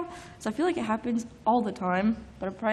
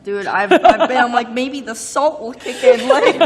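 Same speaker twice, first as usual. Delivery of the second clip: laughing; 9 dB louder than usual. Real, not acted.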